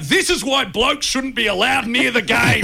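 high, drawn-out mock scream. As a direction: holler it slowly across the ground. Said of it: "I'm screaming out".

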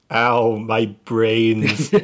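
slow, lightly voiced whiny old man; Moleman voice. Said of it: "and he goes".

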